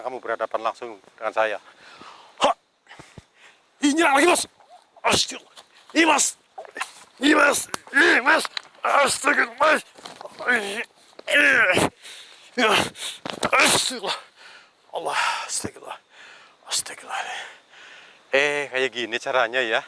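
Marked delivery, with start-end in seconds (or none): 2.4-14.2 high, loud panicked cries to a friend; frantic high-pitched yelling; panicked screaming. Real, not acted. Attacked again, calling to companion. 15.0-17.2 breathless whispered astagfirullah; hushed whispered invocation; whispered prayer. Real, not acted. Shaken after attack, praying.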